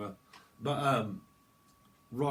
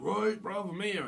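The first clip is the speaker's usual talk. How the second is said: cocky arrival; 8 semitones higher, 3 dB louder than usual. Acted. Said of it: "it's like".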